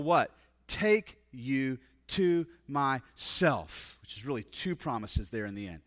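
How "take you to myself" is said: very slow, word by word; deliberate emphasis. Acted. Preacher quoting Jesus's promise to take you, deliberate emphasis. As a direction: space out every word of the promise.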